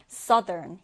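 "southern" with a short UH, as SUH-dern; correct pronunciation. Shown as a demonstration. Teacher modelling the word for learners, "southern" correctly said as SUH-dern. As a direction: say "southern" low, first vowel short, not OW. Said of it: "이렇게 발음해 주는데요".

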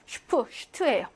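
슈프, 슈트 with a clear sh; sh, never s, in 슈프 and 슈트; sh onset. Shown as a demonstration. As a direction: open each with a hushing sh. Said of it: "처음일 때는".

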